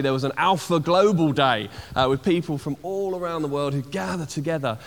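ordinary talk

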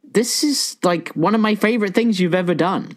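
excited. Retold or imitated. Retold own delight.

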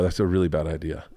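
low, quiet warning to oneself; inner thought. Retold or imitated. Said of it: "in my head, I go".

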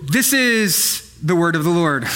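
laughing through a solemn line. Real, not acted. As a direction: try to say it straight but crack up.

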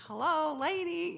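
high, loud and slow call of "Hello, lady"; Lord calling for attention. Retold or imitated. The Lord calls to the lady for her attention, high and loud. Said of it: "trying to get her attention".

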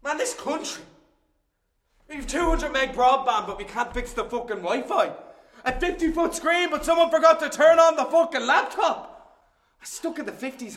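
high, loud furious outburst; angry rant. Acted. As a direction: explode at the broken tech. Tech fails, he rants.

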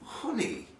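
high, puzzled "Honey?" with a falling end; baffled shop worker. Retold or imitated. Teller mimics confused Tesco worker, high puzzled voice. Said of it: "and she goes".

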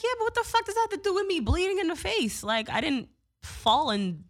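exasperated. Retold or imitated. Answering the paramedics' drinking question, exasperated and high. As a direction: throw it back at them in disbelief.

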